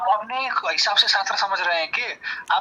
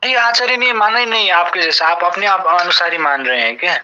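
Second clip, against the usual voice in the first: heated loud retort; 9 dB louder than usual. Real, not acted. Debater cuts in at his opponent with a loud angry retort. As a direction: shout him down in the argument.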